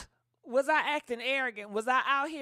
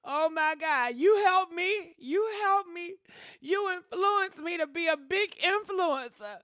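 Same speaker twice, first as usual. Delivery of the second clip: louder, excited quoted gushing; quoting a fan; 5 semitones higher, 5 dB louder than usual. Retold or imitated. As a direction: gush like a grateful fan. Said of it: "they said".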